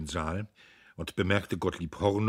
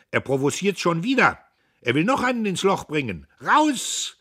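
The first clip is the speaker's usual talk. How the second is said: loud, high outcry rising to a yell; angry mob shouting; 11 semitones higher, 9 dB louder than usual. A narrator's reading. Angry comrades surround Dietrich, shouting him out. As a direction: yell to have him thrown out. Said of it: "wiederholten es aus rauen Kehlen".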